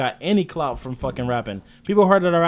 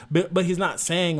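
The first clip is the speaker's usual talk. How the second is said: usual speaking voice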